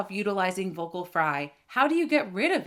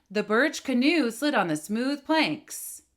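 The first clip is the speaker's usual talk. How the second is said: slow, firm drive through the phrase end; steady push through the end; 3 semitones higher, slower than usual. Shown as a demonstration. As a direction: keep pressing to the last word. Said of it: "Notice how my finger is moving through the end of the phrase".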